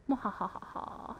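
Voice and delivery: breathy villain cackle; whispered evil laugh. Pretend. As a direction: cackle under your breath. Evil laugh after a kill.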